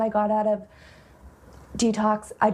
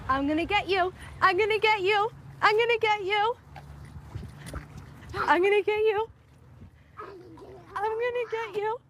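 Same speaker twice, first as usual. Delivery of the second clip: playful chase voice; 11 semitones higher, 3 dB quieter than usual. Real, not acted. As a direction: creep up on the little one, playful and high. Playing chase with her toddler.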